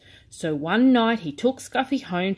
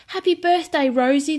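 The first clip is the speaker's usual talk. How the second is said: high and loud, calling out happily; excited cry; 9 semitones higher, 6 dB louder than usual. A narrator's reading. Friends greeting Rosie on her birthday, excited cry. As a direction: cry it out with joy. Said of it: "they cry".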